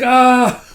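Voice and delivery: high, loud comic yell; short hammed-up swooning shriek; mock scream. Fiona carried like a bride, hammed-up scream.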